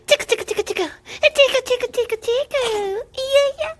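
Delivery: playful squeaky coo while tickling; squeaky tickle talk. Real, not acted. Tickling the baby.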